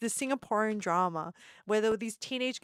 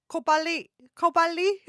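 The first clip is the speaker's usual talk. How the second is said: kau balik in a mock Singaporean accent, said higher and a bit louder; imitating Singaporean slang; Singaporean phrase; 6 semitones higher, 6 dB louder than usual. Vlogger recalls Singaporean words in a mock Singaporean accent. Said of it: "some of the words I only remember".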